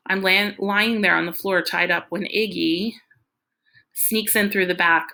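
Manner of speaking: usual speaking voice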